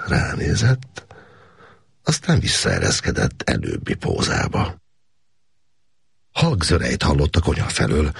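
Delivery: ordinary delivery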